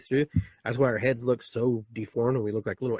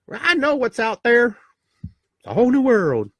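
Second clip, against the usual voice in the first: put-on boasting baby; 11 semitones higher, 8 dB louder than usual. Baby in the womb boasting to the other baby, high and loud. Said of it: "Talking to other baby in the other womb".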